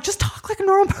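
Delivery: exasperated. Retold or imitated. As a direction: hurry it out, fed up. Retold exasperation at actor.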